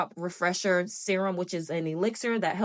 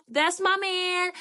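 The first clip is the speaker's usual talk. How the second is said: drawn-out high; 11 semitones higher, much slower than usual. Real, not acted. Introducing husband playfully.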